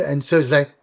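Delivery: ordinary talk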